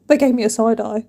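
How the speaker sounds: usual speaking voice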